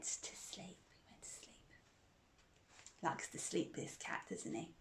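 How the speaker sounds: hushed whisper as the cat sleeps. Real, not acted. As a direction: whisper so you don't wake her.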